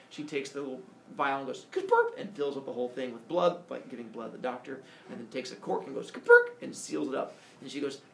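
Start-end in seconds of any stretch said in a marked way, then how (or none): 1.7-2.2 cartoon gulp sound. Sound of filling vial. 6.1-6.5 loud cartoon pop sound. Sound of corking vial.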